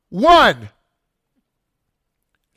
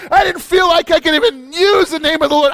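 crying while preaching; 7 semitones higher, 3 dB louder than usual. Real, not acted. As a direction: voice cracking into tears mid-shout. Confessing brokenness, crying heard.

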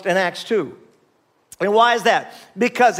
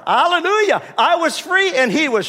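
a preacher's cry of release; joyful shout; 9 semitones higher than usual. Real, not acted.

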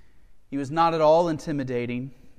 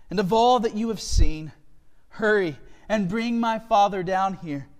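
loud, raised, emotional proclamation; impassioned scripture reading; 5 semitones higher, 6 dB louder than usual. A narrator's reading. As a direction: deliver Joseph's message with full feeling. Resumes reading Joseph's speech.

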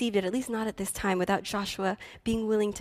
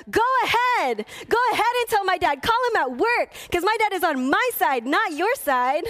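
high, loud, shouted defiance; defiant yell; 10 semitones higher, 5 dB louder than usual. Retold or imitated. Child shouting back at the relative who threatened her, yelling. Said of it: "I yelled".

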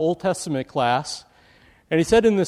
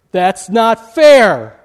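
much louder, slow, stretched-out protest; yelled protest; 5 semitones higher, 11 dB louder, much slower than usual. Retold or imitated. Student yelling at professor: slow loud protest. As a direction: drag out the complaint, loud and slow. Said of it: "was yelled out by one of the students".

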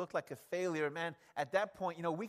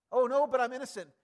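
mock protest; 5 semitones higher, 7 dB louder, faster than usual. Accused man protests his innocence.

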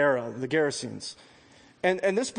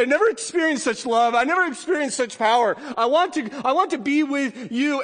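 loud, higher, pleading to follow; eager pleading; 10 semitones higher, 5 dB louder than usual. Acted. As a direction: beg to go with him, overflowing. Healed man begging Jesus.

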